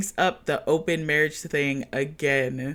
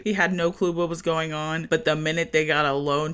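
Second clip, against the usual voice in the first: ordinary talk, nothing marked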